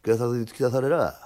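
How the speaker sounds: plain reading voice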